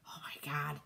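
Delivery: hushed aside under the breath, real annoyance; annoyed whispered aside about spam calls. Real, not acted. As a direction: whisper it so the caller won't hear. Aside about an incoming spam call.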